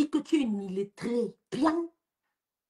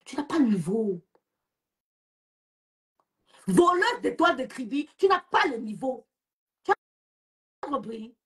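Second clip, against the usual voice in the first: normal talking voice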